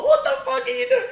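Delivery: hammed-up angry yell through laughter; laughing mock outrage. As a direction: shriek it while cracking up. Retold angry outburst at drunk friend.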